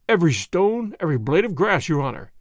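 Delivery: ordinary delivery, nothing marked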